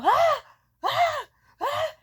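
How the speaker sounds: very high, very loud shrieking ahs; panicked screams. Retold or imitated. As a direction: scream at the sight of blood. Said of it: "And I go".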